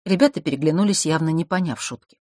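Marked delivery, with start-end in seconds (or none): none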